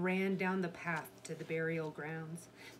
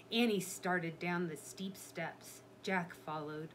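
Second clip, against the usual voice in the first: usual speaking voice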